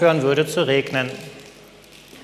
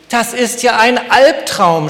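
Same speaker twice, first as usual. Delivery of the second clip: higher and louder, crying out in frustration; frustrated scream; 6 semitones higher, 6 dB louder than usual. A narrator's reading. Thirsty sunflower screaming her frustration at the rain. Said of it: "schrie die Sonnenblume ihren Frust heraus".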